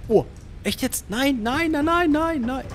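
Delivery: a real cry of disbelief; dismayed nein. Real, not acted. Streamer sees he miscounted, dismayed rising cry.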